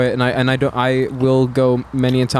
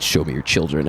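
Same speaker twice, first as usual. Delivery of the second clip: much lower, mock-ominous demand; mock-sinister order; creepy cashier; 9 semitones lower than usual. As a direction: drop low like a menacing clerk.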